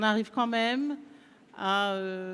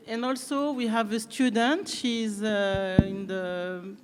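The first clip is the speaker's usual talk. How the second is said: English with a light French accent; French-accented English, lightly done; slight French accent; slower than usual. Real, not acted. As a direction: speak English as a native French speaker would. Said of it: "avec un petit accent français".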